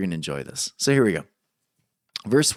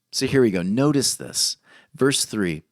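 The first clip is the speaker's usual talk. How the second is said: normal talking voice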